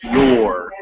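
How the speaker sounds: "your" with the R fully sounded; strong final R. Shown as a demonstration. Teacher coaching a student's word, strong final R. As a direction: land firmly on the r ending "your". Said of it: "you really get that R at the end".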